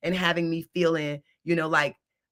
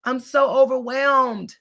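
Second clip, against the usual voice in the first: overwhelmed; 6 semitones higher, 4 dB louder than usual. Retold or imitated.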